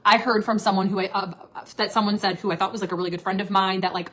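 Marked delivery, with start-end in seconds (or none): none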